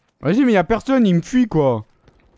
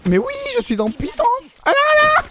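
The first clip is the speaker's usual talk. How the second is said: gleeful squeal; 3 dB louder than usual. Real, not acted. Gloating after winning streak.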